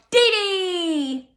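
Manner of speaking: little sister shouts at the photo; delighted shout. Acted. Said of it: "she drops Doodle and shouts".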